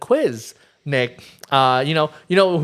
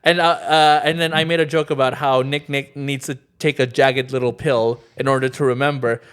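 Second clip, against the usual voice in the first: normal talking voice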